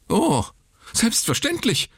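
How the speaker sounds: very high, panicked exclamation; frightened nurse crying out; hysterical. Acted. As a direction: squeal it in panic. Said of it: "rief sie hysterisch".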